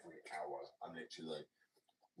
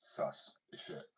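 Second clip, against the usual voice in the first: slow whisper; much slower than usual. Retold or imitated. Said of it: "I was like".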